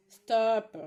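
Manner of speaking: a loud, sharp order to stop; shout at the dogs. Real, not acted. Shouting at her husband's barking dog, loud. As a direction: bark it out to silence the dogs. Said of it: "Je crie un peu".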